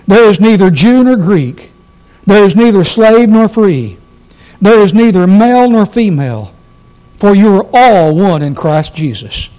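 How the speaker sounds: loud, ringing pulpit reading; full-voiced sermon reading; preaching scripture. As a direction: proclaim each line to the congregation. Reads scripture at full force.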